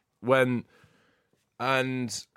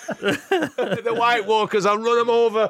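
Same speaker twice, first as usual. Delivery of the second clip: laughing hammed-up bravado; 9 semitones higher, 5 dB louder than usual. Real, not acted. Joking he'll fight dragons.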